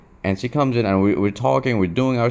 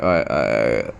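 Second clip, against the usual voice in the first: usual speaking voice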